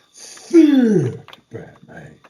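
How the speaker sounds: THICK stretched long, high and loud; stress on THICK. Acted. Repeating the tease about Affleck's Batman, THICK belted long. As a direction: belt out a drawn-out THICK.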